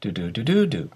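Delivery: "do-do-do-do-do" as five beats, the fourth stressed; five spoken beats, "do-do-do-do-do", peaking on the fourth; syllable beat demo. Shown as a demonstration. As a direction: count out the rhythm on "do-do-do-do-do". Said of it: "has five syllables".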